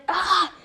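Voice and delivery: short high mock scream; imitated pain scream. Kaba retold as hit into the air, mock cry of pain. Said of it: "he's, like, in pain".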